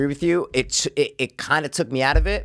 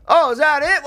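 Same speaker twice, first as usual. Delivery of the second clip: high, louder mimic of a film line; high character voice; 12 semitones higher, 5 dB louder than usual. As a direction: squeak out the overheard line. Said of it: "All you do is hear, like".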